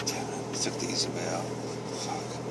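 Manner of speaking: hushed self-scolding whisper. Real, not acted. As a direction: mutter it to yourself. Self-reproach under breath.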